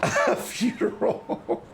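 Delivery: high, slow words broken by real laughter; laughing through words. Real, not acted. Gamer reading out the building's tenants, laughing through words. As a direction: try to speak while cracking up. Still laughing at funeral home.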